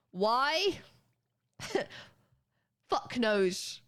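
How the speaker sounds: normal talking voice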